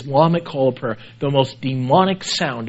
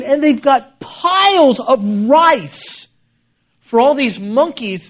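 impassioned preaching; 10 semitones higher than usual. Acted. Preaching at full force, high.

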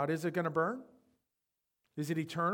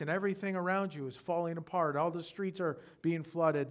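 usual speaking voice

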